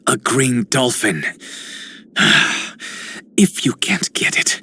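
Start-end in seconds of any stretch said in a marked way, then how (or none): 2.2-2.9 weary sigh. Acted. Character pausing before explaining themselves, weary sigh. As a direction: let out a breathy sigh.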